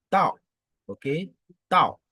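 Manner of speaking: tao falling sharply and loud; fourth tone. Shown as a demonstration. Teacher drilling the falling tao loudly. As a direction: slam tao downward. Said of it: "ton descendant".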